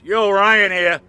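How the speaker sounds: loud, booming phone pickup; imitated phone greeting. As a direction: answer the phone with a holler. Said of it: "all you hear is this".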